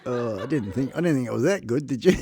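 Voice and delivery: words through laughter; laughing. Real, not acted. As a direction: giggle it out. Laughing while speaking.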